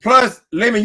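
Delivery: normal talking voice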